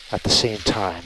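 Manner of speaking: muffled voice inside a helmet; talking from inside a helmet. Real, not acted. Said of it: "I got my helmet on so that I can just relay the audio".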